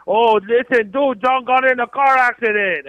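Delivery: high, loud mocking quote of a friend; mock-excited friend's voice; quoting Matthews. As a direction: toss it off in a gleeful mimic. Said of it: "He said".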